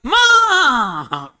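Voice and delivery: long, loud call of Mother. Acted. Son bursting home, calling out loudly for his mother. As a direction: call out to her across the house, drawn out.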